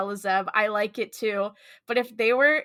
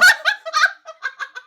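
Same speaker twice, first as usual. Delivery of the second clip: loud high-pitched giggling burst; high laugh; 6 dB louder than usual. Real, not acted. Co-host laughing at the teasing, high and loud.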